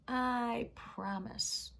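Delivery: very slow drawn-out promise; slow grudging promise. Acted. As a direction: stretch it out reluctantly. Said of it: "she said it again".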